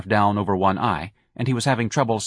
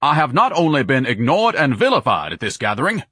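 pompous indignant rant; 5 semitones higher, 4 dB louder than usual. A narrator's reading. Ignatius ranting.

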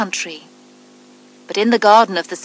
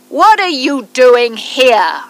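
higher, louder, slow angry giant shout; 5 semitones higher, 7 dB louder, slower than usual. A narrator's reading. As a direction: bellow it slowly at the children. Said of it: "he shouted in a very loud voice".

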